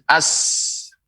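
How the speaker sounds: "As" with a long held s; isolated "As", trailing into a sustained s; held sin. Shown as a demonstration. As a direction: stretch the s of "As", no l.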